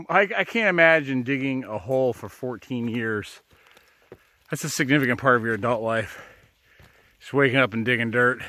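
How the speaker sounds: ordinary delivery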